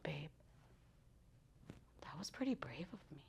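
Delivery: breathy whispered confession, fast. Retold or imitated. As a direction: hush it like in bed at night. Whispering to husband in bed.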